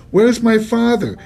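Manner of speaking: high, frantic question; panicked. A narrator's reading. Son crying out for his vanished father. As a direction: cry out in alarm.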